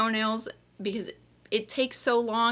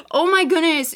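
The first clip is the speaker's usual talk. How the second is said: sharp gasp then a higher, louder oh my goodness; surprised gasp; 10 semitones higher, 7 dB louder than usual. Real, not acted. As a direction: catch your breath, delighted. Gasps at the box.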